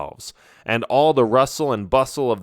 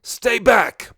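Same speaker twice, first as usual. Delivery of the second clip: put-on high, loud yell of an old wizard; 12 semitones higher, 4 dB louder than usual. A narrator's reading. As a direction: shout a warning in alarm. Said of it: "Barloth yells".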